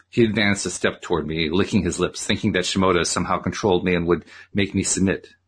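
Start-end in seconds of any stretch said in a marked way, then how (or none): none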